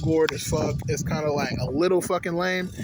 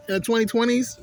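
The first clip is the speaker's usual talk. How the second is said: more incredulous; 6 semitones higher than usual. Shown as a demonstration. Repeating the question to viewers, even more incredulous. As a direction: push the disbelief further.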